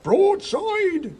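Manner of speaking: very high, loud battle cry; loud, high-pitched command; shouted battle order. A narrator's reading. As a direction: bellow the order at full volume.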